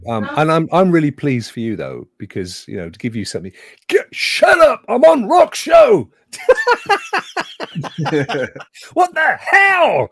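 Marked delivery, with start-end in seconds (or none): 3.9-10.1 loud high-pitched yell at someone in the room; shouting. Real, not acted. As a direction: yell it across the house, annoyed. Yelling at family off-mic.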